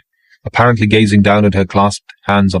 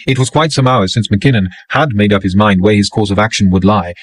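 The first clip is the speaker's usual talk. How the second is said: ordinary delivery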